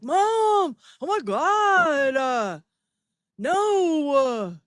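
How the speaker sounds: put-on high, drawn-out whine of an embarrassed kid; whiny son. Grown son protesting to his mom, whiny and high. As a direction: groan at mom like a mortified teenager. Son whining back at mother.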